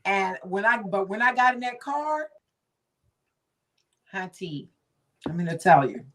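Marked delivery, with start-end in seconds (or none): none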